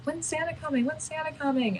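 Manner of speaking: lightly voiced impatient guests; eager passengers. People on the cruise waiting for Santa, high and eager. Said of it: "People kept being like".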